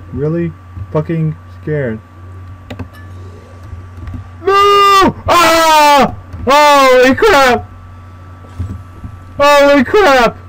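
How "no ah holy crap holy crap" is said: much higher frantic shouts; terrified screaming. Real, not acted. Player facing the monster, terrified screaming. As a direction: scream as it lunges.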